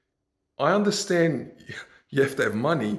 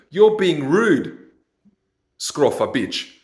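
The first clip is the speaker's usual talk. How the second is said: louder, slow, fed-up jab; angry outburst; 6 dB louder, slower than usual. Real, not acted. Fed-up podcaster lashing out at online women, angry and loud. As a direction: spit it out, exasperated.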